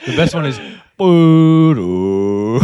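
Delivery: slow, loud foghorn boom; drawn-out barge horn imitation; barge horn noise. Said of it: "like, barge noise".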